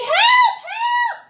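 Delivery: high, loud panicked scream; screaming for help. Acted. Grandmother sees the wolf and screams for help. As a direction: shriek for help. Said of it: "she screamed".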